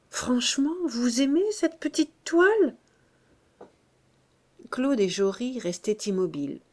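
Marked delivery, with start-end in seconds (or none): 0.1-2.8 high-pitched, uncertain question; anxious, needy. A narrator's reading. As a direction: plead gently for an honest opinion. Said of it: "de l'air inquiet d'un élève qui a besoin d'être encouragé".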